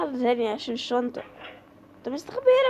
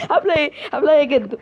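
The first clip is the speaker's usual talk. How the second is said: laughing taunt; 7 dB louder, much faster than usual. Pretend. Mocking grandpa character, laughing.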